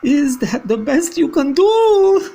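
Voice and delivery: high, loud scornful witch voice; witch cry. Acted. Grand High Witch mocking her witches' efforts, loud and high. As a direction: sneer it at them.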